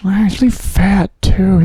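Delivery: high, slow, judging onlooker; Jim Gaffigan's audience voice. Retold or imitated. Audience member whispering about comic, high drawn-out voice. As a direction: comment on the comic in a small high voice. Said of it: "he does the perspective of the audience".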